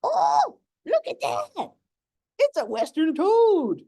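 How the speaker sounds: high, loud thrill at spotting a toad; real delight, voice leaping up; excited gasp. Real, not acted. Nature host spots a western toad, thrilled and high.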